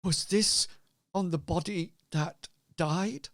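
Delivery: hesitant, upset question in character; tearful innkeeper. Acted. Innkeeper asking Hazra about the dead girl, tearful. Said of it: "stutters a bit and says".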